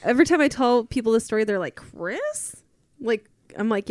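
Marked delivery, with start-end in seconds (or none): 1.8-2.6 high, soft questioning squeak; softly pitched way up in disbelief; mock disbelief. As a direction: imitate people's incredulous reaction. Retells people's disbelieving reaction.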